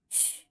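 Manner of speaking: soft, hushed "shh"; quiet acted hush; secretive shushing. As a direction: shush them to guard a secret. Keeping her identity a secret.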